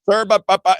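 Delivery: high, loud, stammered protest; mock spluttering. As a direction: imitate a flustered attendant sputtering. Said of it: "starts just going on and on".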